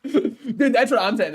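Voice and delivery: laughing through the words; chuckling while agreeing. Real, not acted.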